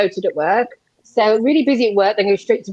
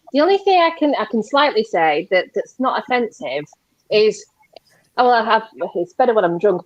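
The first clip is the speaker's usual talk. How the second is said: usual speaking voice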